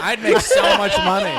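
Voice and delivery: laughing loudly. Real, not acted. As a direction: talk through the laughter. Laughing along at the joke.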